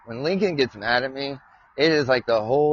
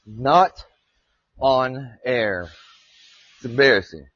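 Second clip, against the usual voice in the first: ordinary talk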